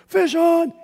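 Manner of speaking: loud, high shout of a fisherman hooking a fish; retold yell. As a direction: call it out across the river. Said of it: "I hear this guy go".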